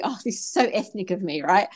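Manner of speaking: ordinary delivery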